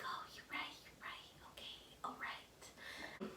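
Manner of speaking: whispered, slow at the end; whispered quote. Retold or imitated. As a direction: hush it to a whisper.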